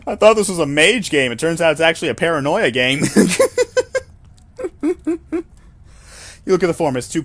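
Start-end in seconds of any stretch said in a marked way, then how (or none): 0.1-3.0 louder, higher, laughing through the words; laughing joke. Real, not acted. Player jokes about the game. 3.1-4.0 loud, high burst of laughter. Real, not acted. Laughter after joke.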